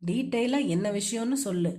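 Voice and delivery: ordinary delivery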